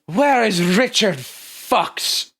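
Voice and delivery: high, very slow, drawn-out demand; stern corporate woman. Acted. Corporate woman on the ramp demanding the missing man, slow and high. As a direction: loom over them, stretching each word. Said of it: "a woman walks down the ramp ... goes".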